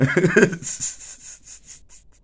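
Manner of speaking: a high, breathy burst of laughter. Real, not acted. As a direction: burst out laughing at your own story. Laugh after the quote.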